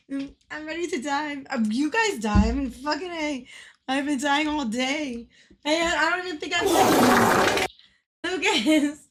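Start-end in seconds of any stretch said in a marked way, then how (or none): none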